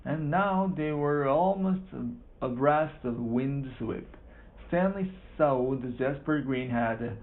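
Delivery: ordinary delivery, nothing marked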